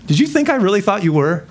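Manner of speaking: lightly hammed-up indignation; teasing retort. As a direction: playfully call them out. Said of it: "You know".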